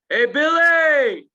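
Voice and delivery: drawn-out, high, loud holler; scream from above. Acted. Man shouting down the well to trapped Billy, screaming. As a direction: call down the well, stretching the name. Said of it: "and screams".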